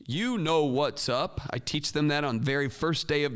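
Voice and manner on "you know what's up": slow, drawn-out chanted reply; class response. Shown as a demonstration. Students answering the teacher's call, drawn-out chant. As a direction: answer back like a whole class. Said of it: "And the class responds".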